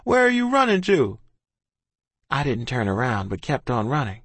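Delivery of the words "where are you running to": high shouted question; raised, high-pitched yell. A narrator's reading. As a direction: call after someone running off. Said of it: "he shouted".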